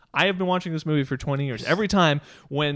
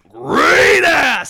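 very high, explosive yell on GREAT; hammed-up sudden scream; overcommitted movie shout; 14 semitones higher, 11 dB louder than usual. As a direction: overcommit hugely to the word great. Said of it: "he just overcommits to great".

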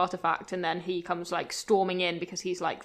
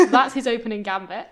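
laughing; 3 semitones higher, 8 dB louder than usual. Real, not acted. Still laughing.

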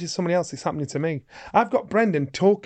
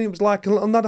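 ordinary talk, nothing marked

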